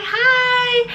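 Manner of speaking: high, drawn-out hi; awkward greeting. Retold or imitated. Greeting girls who mean mug her, high and awkward. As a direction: imitate an over-sweet, uneasy greeting.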